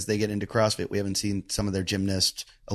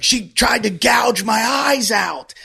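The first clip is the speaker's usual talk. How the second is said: frantic slow shout; 11 semitones higher, 6 dB louder, much slower than usual. Retold or imitated. Friend reporting the attack.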